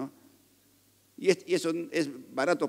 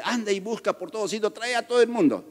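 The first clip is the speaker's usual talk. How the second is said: excited command; 6 semitones higher, faster than usual. Retold or imitated. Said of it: "Y dice".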